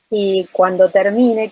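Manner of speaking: usual speaking voice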